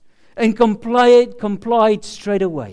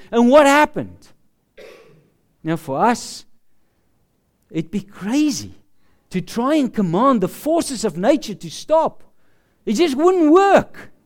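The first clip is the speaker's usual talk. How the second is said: ordinary talk, nothing marked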